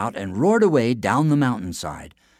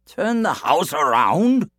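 slow, louder puzzled echo; baffled question; 9 semitones higher, 6 dB louder, slower than usual. A narrator's reading. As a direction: repeat it in disbelief. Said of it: "said Cuchulainn".

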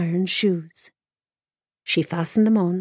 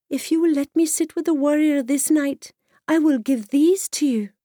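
put-on lightly higher girlish voice; young girl voice; 8 semitones higher than usual. Girl bargains with daughter.

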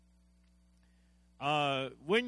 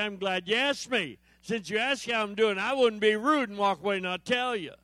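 higher, louder eager answer; keen witness reply; 5 semitones higher, 5 dB louder than usual. Acted. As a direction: beam it back at them.

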